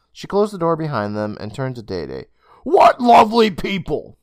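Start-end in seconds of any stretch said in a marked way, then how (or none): 2.6-4.2 higher, louder, slow and delighted; Miss Toll. Acted. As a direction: savour the compliment in a mother's voice. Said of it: "she said".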